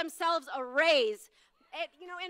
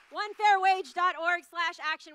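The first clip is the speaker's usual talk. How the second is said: ordinary delivery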